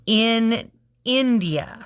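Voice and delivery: In India split slowly into syllables. Shown as a demonstration. Teacher to class, spelling India out slowly in syllables. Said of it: "dividing it into three syllables".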